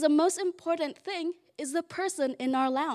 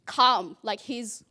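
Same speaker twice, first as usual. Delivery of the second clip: usual speaking voice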